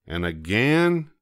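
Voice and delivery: ordinary delivery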